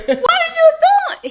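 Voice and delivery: squealing laugh through the question. Real, not acted. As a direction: burst out laughing at the nerve of him. Laughing at his nerve.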